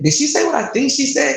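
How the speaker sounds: imitated high-pitched disbelief; mom getting mad. Upset mom texting her daughter about the clerk, incredulous. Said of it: "She's getting mad".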